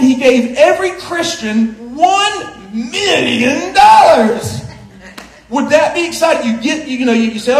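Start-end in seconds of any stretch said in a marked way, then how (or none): 0.0-4.6 mock prize announcement. Preacher misquoting the verse for the congregation, mock grand announcement. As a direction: stretch it out like a game-show host.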